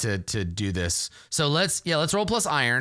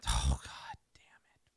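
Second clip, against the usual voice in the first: low whispered curse at a bad roll; dismayed whisper; 10 dB quieter, slower than usual. Real, not acted. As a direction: curse under your breath, slowly.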